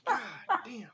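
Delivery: loud fed-up oath; exasperated swearing. Real, not acted. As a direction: drag out the curse in exasperation. Host exasperated at the caller.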